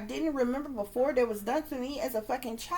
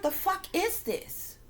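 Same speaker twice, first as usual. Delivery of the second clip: drawn-out, higher-pitched exasperated demand; slow, indignant disbelief, pitched up; pissed off; 7 semitones higher, slower than usual. Retold or imitated. Dreamer reacting to what she sees, pissed off. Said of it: "I begin to get pissed off".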